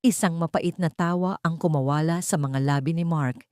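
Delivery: ordinary delivery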